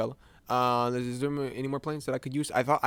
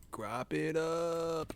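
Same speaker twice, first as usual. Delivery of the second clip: soft slow repeat; 3 semitones higher, 7 dB quieter, much slower than usual. Shown as a demonstration. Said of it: "repeats phrase softly".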